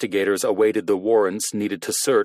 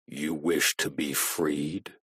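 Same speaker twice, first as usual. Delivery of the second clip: low, slow, soft voice-changer monotone; low robotic voice of doom; 5 semitones lower, 7 dB quieter, slower than usual. A narrator's reading. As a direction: speak like a machine of doom. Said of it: "A low, robotic, monotone voice".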